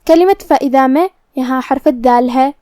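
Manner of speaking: ordinary delivery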